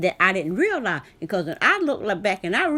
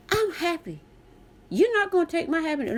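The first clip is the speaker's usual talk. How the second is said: mock cheerful; 7 semitones higher than usual. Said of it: "I'm acting crazy".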